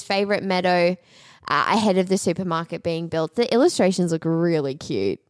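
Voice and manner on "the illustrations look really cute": ordinary talk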